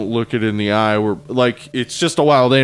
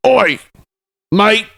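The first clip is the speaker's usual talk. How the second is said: high, indignant mock Aussie; irate Australian accent; 9 semitones higher, 3 dB louder than usual. Angry Aussie listeners objecting, high mock Australian accent. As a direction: yell back in a put-on Australian accent. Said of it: "our Aussie listeners are like".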